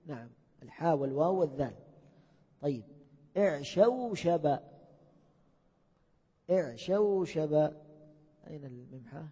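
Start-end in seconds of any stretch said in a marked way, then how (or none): 3.4-7.5 اعشوشب drawn out very slowly syllable by syllable; slow model word. Shown as a demonstration. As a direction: say اعشوشب slowly so students catch every letter. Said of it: "teacher models the word". (نطق اعشوشب ببطء شديد مقطعا مقطعا)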